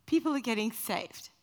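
ordinary delivery

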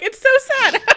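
high, loud teary imitation; teasing impression of weepy voice; mimicking a friend's tears; mock crying. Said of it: "You were like".

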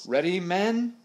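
high, loud call to the crew; rally the men with a loud call; captain calling out to his crew. A narrator's reading. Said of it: "he called out".

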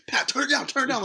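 high-pitched urgent call; retold urgent plea.

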